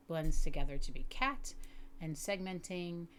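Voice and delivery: ordinary talk, nothing marked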